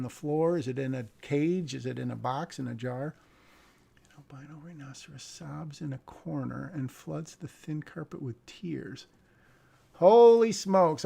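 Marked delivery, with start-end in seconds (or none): 4.0-9.1 soft, ending in a whisper; hushed read. Acted. Reviewer reads the odd rhinoceros line in a hush. Reading script quietly. 9.9-11.0 loud, slow, higher exclamation; amazed exclaim. Real, not acted. Reviewer exclaims at the wild script line.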